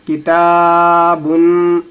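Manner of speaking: long held aa in किताबुन; madd stretch. Shown as a demonstration. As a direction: draw out the ता of किताबुन slowly. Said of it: "इसको खींचना रहता है थोड़ा सा". (किताबुन में ता को खींचकर पढ़ना)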